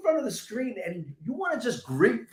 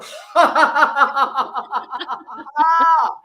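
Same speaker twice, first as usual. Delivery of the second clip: long descending laugh; 7 semitones higher, 8 dB louder than usual. Shown as a demonstration. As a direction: laugh all the way down. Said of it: "laugh on the way down".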